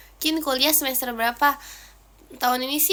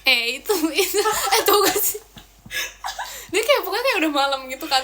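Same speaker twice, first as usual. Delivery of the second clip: laughing; 5 semitones higher, 6 dB louder than usual. Real, not acted. Laughing while starting a story.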